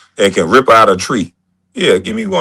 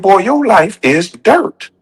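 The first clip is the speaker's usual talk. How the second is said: higher slow quoted voice; imitating the mentor; 9 semitones higher, slower than usual. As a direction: deliver it like wise advice, slowly. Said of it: "He said".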